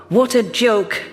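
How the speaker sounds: ordinary delivery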